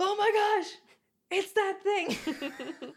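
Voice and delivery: high, loud excited gasp; bursting with sudden realization. Retold or imitated. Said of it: "flipped out".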